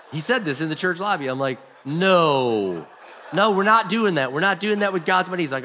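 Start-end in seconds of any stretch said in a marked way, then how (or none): 1.9-5.4 higher, louder, no way we're doing that; firm loud refusal. Retold or imitated. Pastor refusing the casino scheme: firm raised no. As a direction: shut the idea down hard.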